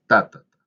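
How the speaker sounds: "Ta, ta, ta" with a loud first beat and two light ones; dactyl beat. Shown as a demonstration. Teacher drumming a dactyl for students, first beat loud. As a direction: tap out the dactyl, stressing the first "Ta". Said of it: "una sílaba acentuada y dos sílabas átonas".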